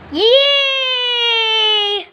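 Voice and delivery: long, high, squealed yay; delighted pretend cheer; excited cheer. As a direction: cheer on one held high note. Newcomer cheers joining the flock.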